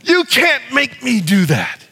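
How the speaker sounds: a mild defiant refusal to the devil. Shown as a demonstration. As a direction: plant your feet and refuse the devil. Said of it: "hands on our hips to the devil".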